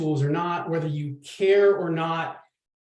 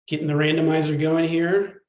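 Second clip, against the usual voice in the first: ordinary talk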